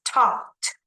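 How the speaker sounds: Talked with the ED as a small t; light final t. Shown as a demonstration. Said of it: "but only like this".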